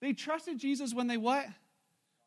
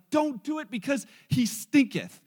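loud, slow King James objection; acted protest; 2 semitones higher, 8 dB louder, slower than usual. Martha objecting to opening the tomb, loud acted protest. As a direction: protest with mock-solemn emphasis.

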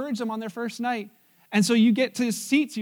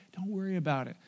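soft reassurance; 3 semitones lower, 8 dB quieter than usual. Acted. Reassuring friend who is a waiter.

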